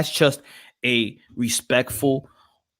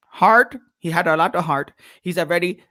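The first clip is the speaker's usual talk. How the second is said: usual speaking voice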